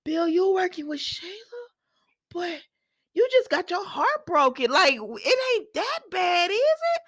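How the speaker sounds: high, pleading incredulous question; disbelieving plea. Retold or imitated. Host pleading with Bill not to join Sheila, high and disbelieving. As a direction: beg him to say it isn't so. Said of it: "I said".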